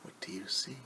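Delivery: softly whispered question. Shown as a demonstration. Teacher whispering a question to children. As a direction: ask it under your breath.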